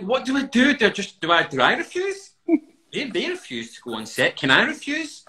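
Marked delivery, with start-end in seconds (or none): 0.0-2.3 panicked dithering. Retold or imitated. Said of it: "I'm like".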